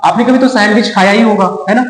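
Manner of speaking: usual speaking voice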